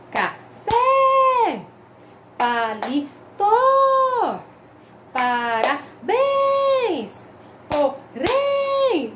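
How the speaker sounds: "café, palito, parabéns, porém", each last syllable held long; exaggerated oxytone stress. Shown as a demonstration. Teacher lists examples, drawn-out last syllables in "café, palito, parabéns, porém". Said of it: "a sílaba tônica recai na última sílaba".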